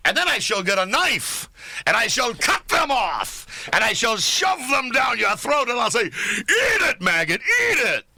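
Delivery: high, rising to a screaming threat; over-the-top shouting. Acted. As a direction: build to a hammy scream. Escalating mock threat, shouting.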